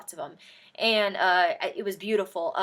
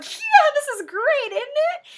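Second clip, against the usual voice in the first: giddy excitement; 9 dB louder than usual. Retold or imitated. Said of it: "I was like".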